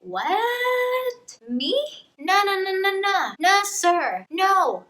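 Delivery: shocked girl refusing, hammed-up; mock disbelief. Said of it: "she's like".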